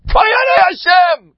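high, loud, slow shout of horror; horrified outcry, drawn out; anguished cry. Acted. Footmen refuse, crying out.

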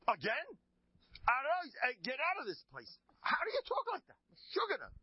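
very high, loud indignant outburst; acted furious shout. Rabbi to gabbai whispering a second time, acted furious shout. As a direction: explode in theatrical outrage.

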